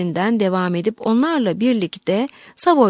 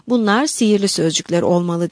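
usual speaking voice

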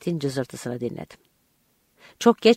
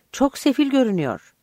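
high-pitched agitated remark; narrated wild reaction, pitched well up; crazed voice; 8 semitones higher, 3 dB louder than usual. A narrator's reading. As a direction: blurt it out high, as if frantic. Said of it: "sesi çılgın gibi çıktı".

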